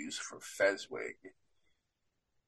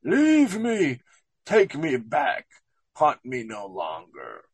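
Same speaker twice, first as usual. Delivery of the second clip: distraught Scrooge; 2 semitones higher than usual. Acted. Director reading Scrooge's plea to the ghost, acted distraught. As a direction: plead in mock distress, easing off as you go. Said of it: "distraught, Scrooge cries out".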